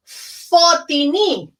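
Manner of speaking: Fotini said slowly, FO-tee-nee with a rolled Scottish feel; Scottish-style Fotini. Shown as a demonstration. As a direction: stretch out Fotini slowly, stress on the first syllable. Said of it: "have a Scottish accent and say".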